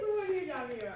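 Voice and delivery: cracking up mid-sentence; laughing. Retold or imitated. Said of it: "I was like".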